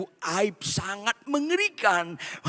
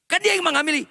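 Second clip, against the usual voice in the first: high, insistent protest; daughter's protest; 10 semitones higher, much faster than usual. Acted. Maria telling her father, high and insistent. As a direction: insist it was him.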